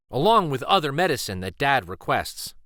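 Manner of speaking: ordinary delivery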